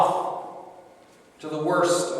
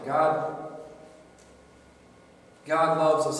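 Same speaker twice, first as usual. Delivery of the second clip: ordinary talk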